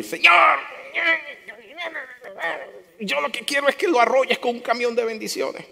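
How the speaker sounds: high-pitched mock prayer asking for revenge; mock vengeful prayer. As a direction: ham up a spiteful prayer.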